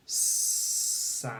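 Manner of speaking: "Samp" stretched sound by sound, with a long hissed s. Shown as a demonstration. Teacher reads back a misspelt word to pupils, sounded out. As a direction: draw "Samp" out slowly, each sound separate. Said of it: "Listen carefully".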